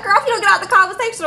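fast, sassy telling-off; sassy rapid retort. Retold or imitated. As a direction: rattle it off at the nosy clerk. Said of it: "I was like".

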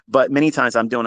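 ordinary talk

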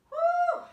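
high loud whoop mid-exercise; high woo of effort. Real, not acted. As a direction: let out a high straining whoop. Exclaim during exercise.

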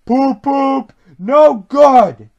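toot, then grumble loudly; grumpy storybook engine; grumbling. A narrator's reading. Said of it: "grumbled Gordon".

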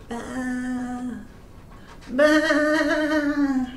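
a gentle baa baa; sheep bleat. A narrator's reading. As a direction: bleat like a lost lamb.

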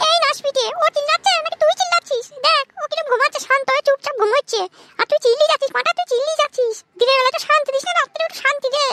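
high, squeaky mother telling him off; high-pitched, fed-up scolding; exasperated mother. Acted. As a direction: nag in a very high cartoon mum voice. Skit mother blames the shouter.

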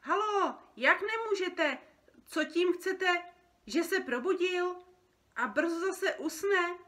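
high, loud, incredulous questions; agitated phone protest. Acted. Creature on a phone call about a woken dreamer, loud and agitated. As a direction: fire exasperated questions down the line.

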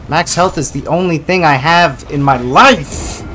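loud high exasperated complaint; frustrated rant. Real, not acted. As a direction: whine loudly at the unfair game.

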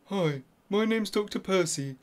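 normal talking voice

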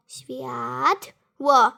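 "Światło" drawn out as "świat", pause, "ło"; slow, separated syllables in "Światło"; split into syllables. Shown as a demonstration. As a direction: sound out "Światło" in two beats. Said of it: "Weźmy światło".

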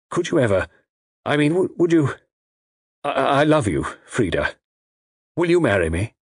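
halting, stammering declaration of love; falter, then get the question out; hesitant, earnest suitor; nervous proposal. A narrator's reading.

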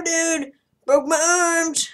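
ordinary talk, nothing marked

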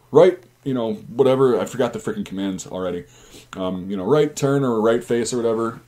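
ordinary delivery

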